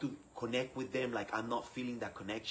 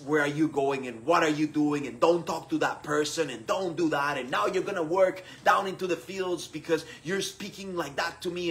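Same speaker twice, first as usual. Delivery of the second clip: loud, higher-pitched bossy scolding; angry ordering; 4 semitones higher, 7 dB louder than usual. Retold or imitated. Insecure leader ordering the community around, angrily. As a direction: lay down the law, acting out anger. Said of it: "very angry, thinking that if I would get angry".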